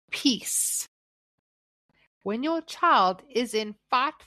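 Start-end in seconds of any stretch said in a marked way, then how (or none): 0.1-0.9 a breathy whisper of the single word; hushed whisper. Real, not acted. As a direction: breathe the word out, voiceless, like a hush. Devotional soft emphasis on peace.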